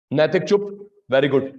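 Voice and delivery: ordinary delivery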